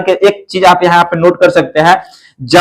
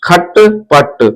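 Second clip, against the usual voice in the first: खटपट said very slowly, split into खट and पट; slow syllable split; much slower than usual. Shown as a demonstration. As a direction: draw out खटपट piece by piece. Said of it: "खटपट मत कर". (खटपट धीरे-धीरे टुकड़ों में)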